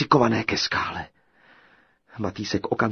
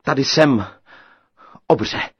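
loud, plucky call-out; brave call; 4 semitones higher, 9 dB louder than usual. A narrator's reading. Tailor steps out of hiding, loud brave call. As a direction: call out boldly to the giant. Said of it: "zavolal; sebral veškerou svou odvahu".

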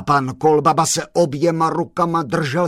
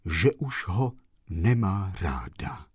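whispered sorrow; 8 semitones lower, 8 dB quieter than usual. A narrator's reading. Sad chauffeur's lament, whispered.

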